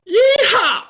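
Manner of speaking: long, high whooping cowboy yell; stretched-out yeehaw shouted from the chest. Pretend. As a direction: let rip a big rodeo holler. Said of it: "here's what I'm gonna do for you".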